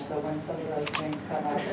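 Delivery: ordinary delivery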